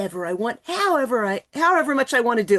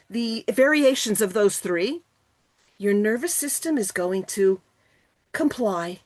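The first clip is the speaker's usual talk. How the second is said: ordinary talk, nothing marked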